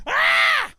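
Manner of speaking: sudden high loud yell "Ah"; loud shout. Shown as a demonstration. Host showing how he walks New York, a sudden yell. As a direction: let out a startled scream. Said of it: "walking around going".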